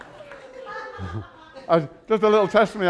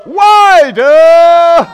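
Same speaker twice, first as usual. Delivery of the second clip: shouted preaching, higher and louder; preaching at full force; 10 semitones higher, 13 dB louder, much slower than usual. Acted. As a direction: build to a shouting climax. Preaching crescendo.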